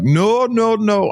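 sharp high alarmed refusal. Retold or imitated. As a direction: cry out no in alarm. Driver refuses to let girl in.

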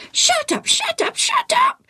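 very high bird squawk; screeching parrot. A narrator's reading. Parrot circling the tent, screeching. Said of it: "the parrot screeched".

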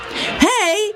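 put-on high boyish shout of Hey; a schoolboy calls to his pals; boy calling out. A narrator's reading. Schoolboy calling to his friends on the snowy road, high and eager. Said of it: "Bill said as they pranced".

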